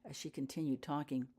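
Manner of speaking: ordinary delivery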